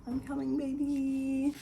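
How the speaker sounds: calling out. Real, not acted. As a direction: sing it out toward the bedroom. Calling to waking daughter.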